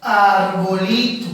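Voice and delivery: Arbolito said slowly, every syllable laid out; teacher dictating Arbolito at a slow, careful pace; slow, clear example word. Shown as a demonstration. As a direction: spell out Arbolito slowly for the class. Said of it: "yo digo de repente arbolito".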